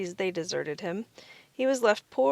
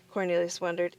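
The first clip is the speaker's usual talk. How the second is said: ordinary delivery, nothing marked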